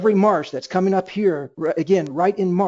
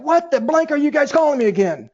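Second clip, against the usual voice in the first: mock irritated caller, pitched up; annoyed prospect; 8 semitones higher, 3 dB louder than usual. Prospect snapping at a repeat caller, mock annoyed. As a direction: play a fed-up person getting another call. Imagined angry prospect on phone.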